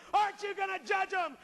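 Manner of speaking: shouted demand from Satan; Satan screaming. Acted. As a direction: scream it up at the throne. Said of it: "Satan's screaming at him".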